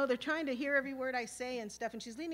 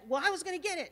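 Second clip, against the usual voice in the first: louder, higher stubborn insistence; insisting; 7 semitones higher, 7 dB louder, faster than usual. Retold or imitated. Gangster girl insisting the pump is hers, louder and higher. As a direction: she won't back down.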